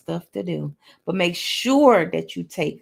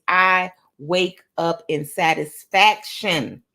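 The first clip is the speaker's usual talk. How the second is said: slow, loud "satisfaction"; "satisfaction" drawn out slowly with force; emphatic affirmation; 5 dB louder, much slower than usual. Shown as a demonstration. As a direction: stretch every word and punch "satisfaction".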